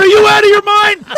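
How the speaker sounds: high, loud incredulous yell; retold shout again. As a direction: bellow it like he's lost it. Retold shouting at friend in car.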